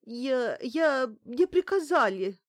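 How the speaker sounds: stumble, then blurt it out in confusion; rattled, panicky confession; flustered blurting. A narrator's reading. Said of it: "растерянно выпалила Банкина".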